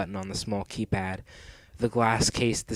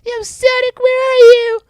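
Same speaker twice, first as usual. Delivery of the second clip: very high, loud cartoon cry; piercing high kid voice; squeaky little fox; 15 dB louder than usual. A narrator's reading. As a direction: call out for rescue in a tiny voice. Tails calls for rescue.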